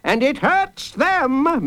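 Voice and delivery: high, slow proclamation; judge calling out. Acted. Judge leading the big people, calling out slowly. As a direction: pitch it high and leave it hanging.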